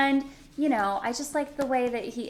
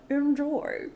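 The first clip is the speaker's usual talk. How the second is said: higher, mock bow delivery; bowing Enjoy with a mouthful; 5 semitones higher than usual. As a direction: imitate a waiter with a bow. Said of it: "but he kinda bows".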